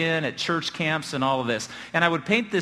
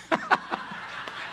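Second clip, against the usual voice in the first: high breathless laugh; real laugh; 5 dB quieter than usual. Real, not acted. Preacher cracking up at the nurse's question, laughing. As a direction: break up laughing at the joke. Laughing at his own story.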